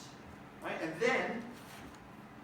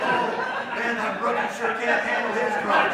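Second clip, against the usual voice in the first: louder, amused mockery; mock the rookie with a laugh; laughing smug ribbing; laughing teasing jab; 4 semitones higher, 10 dB louder, slower than usual. Mocking Kenny's weakness.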